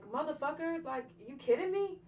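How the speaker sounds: mock-explode at him again in disbelief; telling off her attacker with a mild retold yell; retold blow-up. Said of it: "I just blew up".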